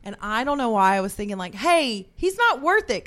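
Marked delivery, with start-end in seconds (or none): 1.5-3.0 louder, higher mock call to calm him. Retelling protest to podcast, a louder mock call-out. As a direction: call it out to settle a fight. Retold calming shout.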